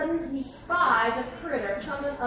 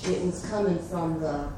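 ordinary delivery, nothing marked

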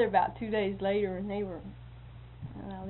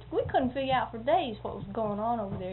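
ordinary talk, nothing marked

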